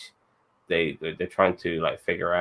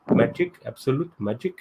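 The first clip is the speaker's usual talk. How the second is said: higher-pitched put-on Indian accent; 5 semitones higher than usual. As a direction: act Kamala's dad with a lilting Indian accent. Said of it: "That's a good Indian accent".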